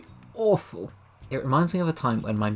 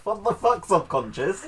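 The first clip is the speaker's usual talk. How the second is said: higher, louder shout through laughter; laughing mock outburst; 9 semitones higher, 3 dB louder than usual. Teasing her partner about his dream: a laughing mock shout. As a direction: blurt it out cracking up. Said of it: "You'd be like".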